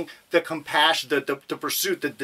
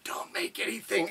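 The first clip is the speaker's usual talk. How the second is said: much softer, slightly higher mock hush; 4 semitones higher, 10 dB quieter than usual. Imitating space-movie crews keeping quiet as ships approach, hushed. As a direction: say it under your breath, like a movie crew hiding. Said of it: "They always quiet down".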